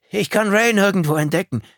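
high, loud, worried call. A narrator's reading. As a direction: shout it across the cabin. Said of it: "rief Carter".

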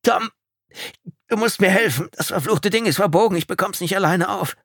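high, loud, strained urgency; desperate plea. A narrator's reading. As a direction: beg a friend for help through pain. In pain, begging friend for help.

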